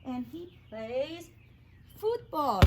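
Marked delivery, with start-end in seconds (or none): 2.0-2.6 \"football\" dito devagar e claro, como para a turma repetir; model word. Shown as a demonstration. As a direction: say football clearly for the class to repeat. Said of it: "Can everybody say football?".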